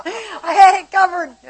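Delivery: high, loud words through laughter; laughing boast. Real, not acted. Telling the class Jackie would do it, laughing. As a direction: crow it while cracking up.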